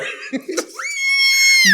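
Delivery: high, loud shrieking laugh. Real, not acted. Comedian cracking up at own joke, high shrieking laugh. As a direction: let out a squealing cackle.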